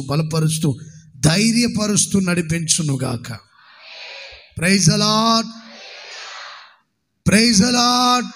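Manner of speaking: slow, fervent blessing over the congregation; prayerful blessing. Acted. Pastor blessing the lonely and bereaved, fervent prayer voice. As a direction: bless them with steady conviction.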